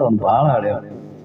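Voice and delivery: ಹಳೇವನು dropped low and soft; low murmured aside. Shown as a demonstration. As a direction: let "ಅವ್ನು ಭಾಳ ಹಳೇವನು" fall away quietly. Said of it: "ಪುರಾಣ ಅಂದ್ರೆ ಹಳೆಯವನು".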